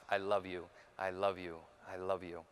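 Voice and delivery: very low, soft repeated mantra; meditative self-soothing. Retold or imitated. Meditating, loving his inner child.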